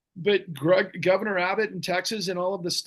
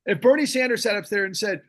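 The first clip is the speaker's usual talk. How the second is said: ordinary delivery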